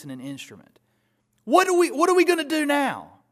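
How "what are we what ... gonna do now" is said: high, loud, panicked cry; frantic plea. Acted. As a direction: beg for an answer in sudden panic. Acted desperate crowd.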